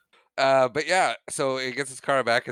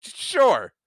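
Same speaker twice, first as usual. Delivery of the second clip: higher, louder flustered stammer; stammering sure; 11 semitones higher, 7 dB louder than usual. Retold or imitated. Old Biff caught out, flustered higher stammer. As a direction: stumble over the word, caught out.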